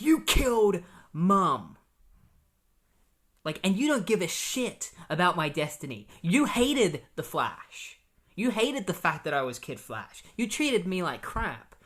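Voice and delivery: retold son screaming at his dad; acted furious yelling. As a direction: hammer each accusation at the father. Wally blows up at his dad.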